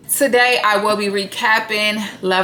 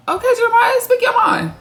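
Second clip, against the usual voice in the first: much higher, mock-impressed sing-song; a sarcastic high "Okay, Jeremiah"; sarcastic cheer; 14 semitones higher than usual. Real, not acted. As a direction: egg him on with fake praise.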